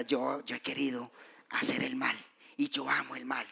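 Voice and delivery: whispered, ashamed confession; hushed confession. Pretend. Whispered confession from a congregant. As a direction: whisper it like a secret sin.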